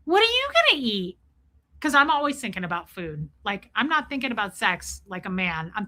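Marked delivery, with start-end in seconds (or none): 0.1-1.2 high, clueless voice; mock dumb self, pitched way up; dumb question. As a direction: sound totally oblivious. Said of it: "And my dumb ass goes".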